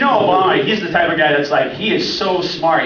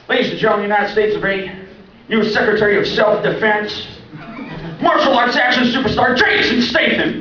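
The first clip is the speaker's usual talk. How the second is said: hammed-up boxing announcer; mock announcer intro; 8 semitones higher, 4 dB louder than usual. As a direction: hype him up like a ring announcer. Announcer introducing Jason Statham.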